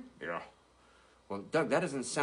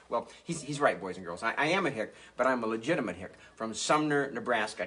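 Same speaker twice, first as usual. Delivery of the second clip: usual speaking voice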